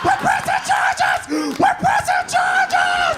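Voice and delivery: high, slow shrieked threat; screaming kid. Retold or imitated. Brother shrieking a legal threat at his big brother. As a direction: shriek it like a tattletale.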